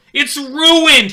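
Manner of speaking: high, loud wail of dismay; panicked shout. Acted. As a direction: cry out over the ruined crop. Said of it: "he shouts".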